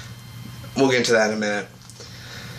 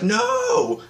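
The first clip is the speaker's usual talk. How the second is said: high, drawn-out mock "no"; imitated protest cry; 9 semitones higher than usual. Laura as Nyssa gets taken, high mock wail. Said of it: "Laura was like".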